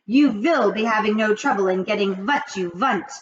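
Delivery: loud witch voice; witch shout. Acted. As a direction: assure them loudly. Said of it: "shouted the Grand High Witch".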